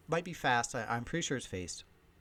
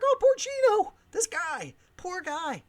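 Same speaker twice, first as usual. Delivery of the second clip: mock pity; 7 dB louder, slower than usual. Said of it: "Now everybody's like".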